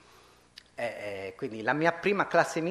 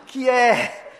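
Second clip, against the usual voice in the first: high, loud cry broken by a laugh; strangled cry; 13 semitones higher, 12 dB louder than usual. A narrator's reading. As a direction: cry out, half laughing at the drama. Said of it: "gridò la donna con una voce strozzata e strana". (grido strozzato, mezzo ridendo)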